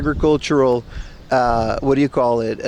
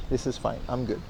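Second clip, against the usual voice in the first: low, soft nervous mutter; quiet muttering; 4 semitones lower, 12 dB quieter than usual. Real, not acted. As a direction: mumble it to yourself, barely audible. Repeating reassurance while scared.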